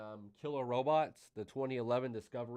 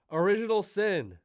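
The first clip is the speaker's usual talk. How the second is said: loud announcement; 8 semitones higher, 11 dB louder than usual. Real, not acted. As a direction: announce it big. Announcing his pick loudly.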